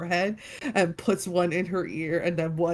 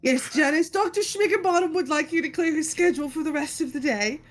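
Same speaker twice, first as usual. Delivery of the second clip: higher-pitched, officious message; child playing assistant; 7 semitones higher than usual. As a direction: relay the order like a kid at play. Child assistant relays doctor's order.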